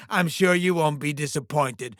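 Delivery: ordinary talk, nothing marked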